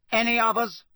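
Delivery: cold. A narrator's reading. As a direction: ask icily. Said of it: "said Crouch coldly".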